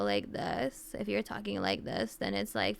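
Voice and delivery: low, creaky fry on every word; vocal fry. Shown as a demonstration. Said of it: "vocal fry".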